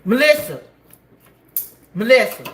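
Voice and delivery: loud call, name stretched. Real, not acted. Calling Melissa across room.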